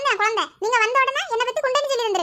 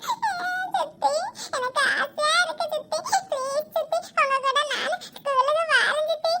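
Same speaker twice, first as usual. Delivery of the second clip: higher, slow, crying plea; tearful pleading; 5 semitones higher, slower than usual. Acted. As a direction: beg through tears to go to school. Child begs to go to school. (பள்ளிக்கு செல்ல அழுதபடி கெஞ்சல்)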